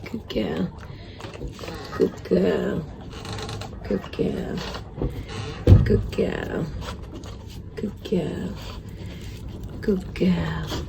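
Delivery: soft whisper to the puppy; whispered praise. Real, not acted. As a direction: hush it so she keeps eating. Repeated soft praise while eating.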